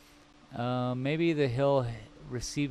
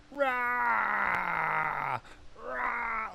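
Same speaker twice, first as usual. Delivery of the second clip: a much higher, strained monster roar; growling tree; 10 semitones higher, 3 dB quieter than usual. Acted. Painter voicing the tree coming at the viewer, mock roar. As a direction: roar like a tree lunging at you. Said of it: "I'm trying to emulate the tree".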